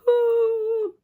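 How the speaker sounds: high, loud hooting fake wail; exaggerated boo-hoo sob, imitating her; mock crying. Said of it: "She started crying, y'all. Like".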